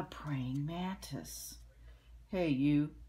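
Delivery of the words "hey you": loud, gruff challenge; grouchy ladybug. A narrator's reading. Grouchy ladybug accosting a stag beetle, grouchy. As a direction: call out loudly as a grouchy bug. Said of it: "said the grouchy ladybug".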